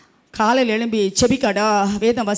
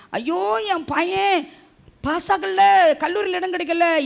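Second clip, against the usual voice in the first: a hint of a mother's lament, pitched high; wailing mother; 9 semitones higher, slower than usual. Pastor imitating mothers crying over exam results, mild high wail.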